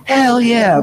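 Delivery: lightly voiced wrestler rallying the crowd; a hint of a wrestler hyping a crowd; Stone Cold hype. Wrestler asks the rally crowd for a hell yeah, hammed-up. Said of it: "Stone Cold is sitting there going off".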